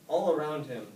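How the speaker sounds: usual speaking voice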